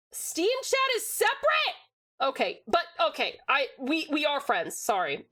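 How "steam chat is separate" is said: high, slow disbelieving question; realizing something with surprise; surprised. Real, not acted. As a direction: genuinely caught off guard. Surprised at Steam chat.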